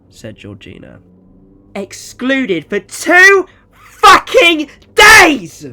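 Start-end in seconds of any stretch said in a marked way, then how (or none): 1.8-5.6 slow, screaming rage, much higher and louder; a mother losing it at her child; furious mother. Acted. Mother furious at excluded son.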